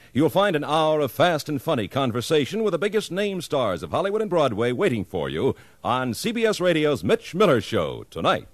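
ordinary talk

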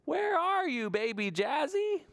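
high, slow drawn-out call; mother calling. Acted. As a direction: call out for the hiding baby, stretched. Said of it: "looks by the Fliff Fluff Flowers and says".

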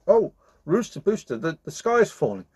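high and frightened, telling the rooster; anxious cry. Acted. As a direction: fluster the alarm to a friend. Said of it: "she cried".

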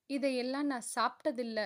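plain reading voice

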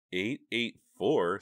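uneasy surprise. Acted. Girl startled by address.

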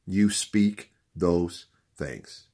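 word by word, at half pace; deliberate, weighty teaching delivery; slow emphatic repeat. Real, not acted. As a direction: spell it out slowly with pauses.

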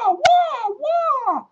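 very high, loud, hammed-up wah; kooky magician wail. Kooky magician snapping fingers, wailing a high silly wah. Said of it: "be in a kooky wooky Maduki magician".

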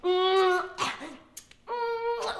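long, high-pitched "mmm" of a big kiss; hammed-up smooching hum; exaggerated kissing noise. Showing how she kissed her date, exaggerated kissing noise. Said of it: "really kiss him. Like".

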